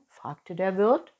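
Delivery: usual speaking voice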